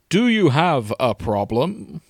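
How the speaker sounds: Matt Berry. Acted. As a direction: draw the question out pompously. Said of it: "He's getting Matt Berry".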